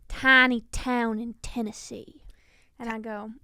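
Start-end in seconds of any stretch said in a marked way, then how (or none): 0.1-2.2 slow, louder Southern drawl; friend's Tennessee line in a hammed-up drawl; country accent. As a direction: lay the Tennessee twang on thick. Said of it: "he would always go".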